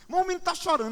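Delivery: high-pitched mock alarm; wife in crisis. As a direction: imitate a distressed wife raising the alarm. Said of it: "minha esposa ficava em crise". (esposa em pânico, imitada)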